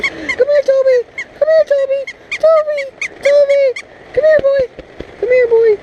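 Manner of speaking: very high-pitched sing-song dog call. Real, not acted. Calling Toby the dog over in a very high squeaky voice.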